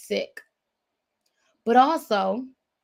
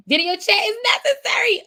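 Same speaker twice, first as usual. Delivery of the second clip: mock wail; 11 semitones higher, 5 dB louder, faster than usual. Mock-whining the man's line.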